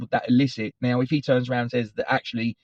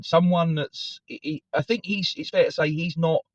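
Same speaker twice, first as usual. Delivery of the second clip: ordinary talk, nothing marked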